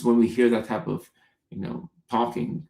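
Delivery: usual speaking voice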